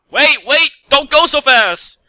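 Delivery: high, loud desperate shout; calling after her. Acted. Mech chasing the fleeing Pegasus, loud high shout. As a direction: yell after someone flying off, slow and stretched.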